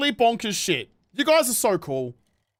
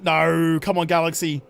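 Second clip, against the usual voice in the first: ordinary talk, nothing marked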